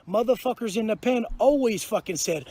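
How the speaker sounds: ordinary delivery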